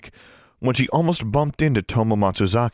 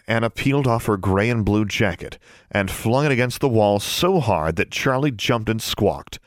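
ordinary delivery

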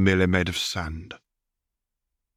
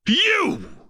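shrieking scream, pitched far higher; losing all control; furious scream; 3 dB louder than usual. A narrator's reading. Slave master screaming at the Wraith, shrieking fury. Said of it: "the master screamed this time".

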